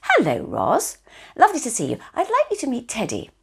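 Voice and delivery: granny modelling a perfect introduction to her sister; model introduction. Shown as a demonstration. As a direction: show the right way to introduce someone. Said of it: "So I would say".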